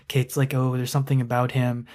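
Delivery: ordinary talk, nothing marked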